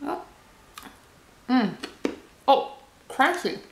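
talking while chewing chocolate; muffled behind hand over mouth; mouth full, chewing. Real, not acted. Seen 0: bites chocolate at -1, chews, then covers mouth with hand from 1.3.

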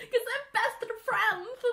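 high, drawn-out, laughing coo; gushing. Real, not acted. Fan explains the hug of best friends, high gushing. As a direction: squeak it with mock-tearful delight.

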